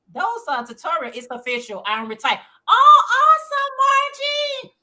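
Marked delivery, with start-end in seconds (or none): 2.7-4.7 much higher, loud delighted cry; excited cheer. Real, not acted. Host cheering a viewer who just retired, excited cry. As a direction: cheer her news out loud.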